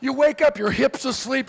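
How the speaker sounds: ordinary talk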